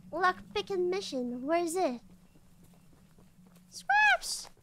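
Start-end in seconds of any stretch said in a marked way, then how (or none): 3.7-4.5 excited squeal. Real, not acted. Gleeful cry over scraps.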